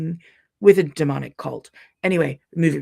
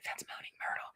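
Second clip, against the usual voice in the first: breathless whisper in a dark theater; 18 dB quieter than usual. Retold or imitated. Whispering to her husband during the movie. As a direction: hush it so nobody nearby hears. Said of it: "little list in the movie theater".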